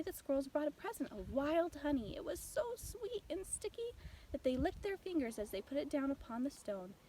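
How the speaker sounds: ordinary delivery, nothing marked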